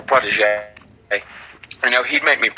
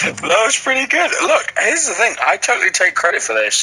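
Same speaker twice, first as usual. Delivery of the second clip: high squeaky boast; high gloating voice; 9 semitones higher than usual. Pretend. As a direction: gloat in a much higher voice.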